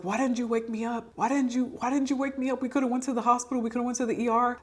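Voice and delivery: high, whiny, exasperated husband complaint; husband's mock exasperation. Husband scolds her the next morning, in a high mock-exasperated voice. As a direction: imitate him fretting that she didn't wake him. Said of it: "he was so mad".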